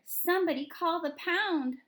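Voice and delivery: high, loud yell across the store; manager yelling. Acted. Store manager calls for the pound, yelling. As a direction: call out loudly for help. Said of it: "he was yelling a lot".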